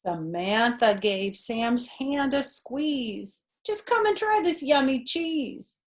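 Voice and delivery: put-on lively read-aloud for a child; animated children's story narration; storybook reading. A narrator's reading. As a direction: read it like a bedtime picture book. Reading picture book aloud.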